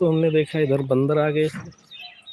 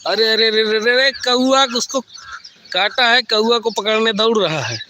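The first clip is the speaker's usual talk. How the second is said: excited; 6 semitones higher, 3 dB louder than usual. Real, not acted. Crow attacking the monkey.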